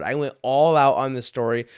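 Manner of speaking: ordinary talk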